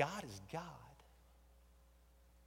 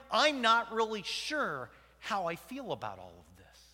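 ordinary delivery

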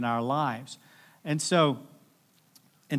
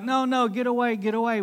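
higher, urgent no, no, get away; frantic push-away; 8 semitones higher than usual. Retold or imitated. Peter begs Jesus to leave, high and urgent. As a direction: shoo him off in panic. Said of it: "Peter's like".

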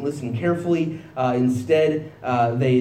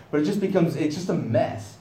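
ordinary talk, nothing marked

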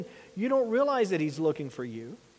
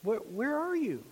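higher, soft gentle call; 5 semitones higher, 4 dB quieter than usual. Shown as a demonstration. God calling to hiding Adam, gentle. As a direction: ask it gently, coaxing. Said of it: "Was it gentle?".

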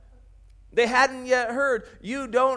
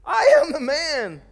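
slow, louder and higher insistence; insisting; 8 semitones higher, 9 dB louder, slower than usual. A narrator's reading. Healed man insisting to neighbours, slow and loud. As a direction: plant your feet and insist. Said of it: "But he himself insisted".

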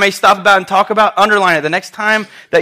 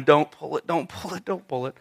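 hushed, repeated frantic plea, trailing softer; mock frantic; 2 semitones lower, 9 dB quieter than usual. Hurt teacher begging the nurse over a splinter, mock frantic. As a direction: retell a panicked plea under your breath. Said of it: "frantically going".

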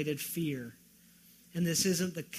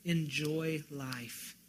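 slow quote; 4 semitones lower, 7 dB quieter, much slower than usual. Shown as a demonstration. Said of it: "what Solomon says".

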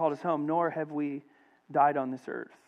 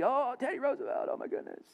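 high, hushed mock gushing; excited crowd; 8 semitones higher, 6 dB quieter, faster than usual. Crowd greeting Teddy Roosevelt, mock excited gushing. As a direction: imitate a starstruck fan, high then breaking into whisper. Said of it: "Everybody's excited to see Teddy".

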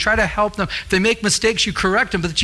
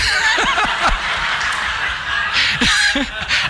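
a long, high, airy burst of laughter; real amused laughter, mostly breath; breathy high laugh. Real, not acted. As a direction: laugh helplessly at the memory. Laughing at the boss's turnaround.

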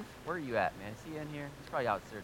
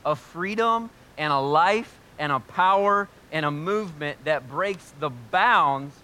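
higher, louder, slow preaching push; impassioned preaching; 7 semitones higher, 10 dB louder than usual. Acted. Pastor urging the congregation toward Jesus, raised and passionate. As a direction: preach it with rising fire.

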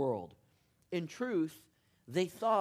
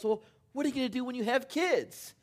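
exasperated dad; 10 semitones higher, 4 dB louder, faster than usual. Retold or imitated. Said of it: "and he goes".

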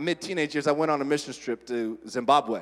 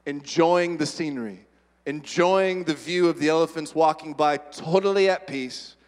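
ordinary talk, nothing marked

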